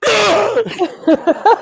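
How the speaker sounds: loud high scream to scare them; startled scream. Retold or imitated. Faking death then screaming at the girls. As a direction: burst out screaming as if waking. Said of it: "I go".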